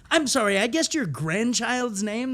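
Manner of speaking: stunned that the guess landed; astonished disbelief. Retold or imitated. Improviser amazed he guessed the grandchild's name, high disbelief. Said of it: "I was like".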